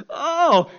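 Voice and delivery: high hammed-up sobs into a loud wail; mock crying. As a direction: imitate helpless sobbing, ending in a wail. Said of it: "people that cry".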